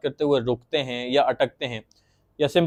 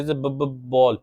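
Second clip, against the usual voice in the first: stuttering on b; much slower than usual. Shown as a demonstration. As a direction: repeat the b sound, stuck. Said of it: "ये जो बी का ब sound है इसको repeat करते थे".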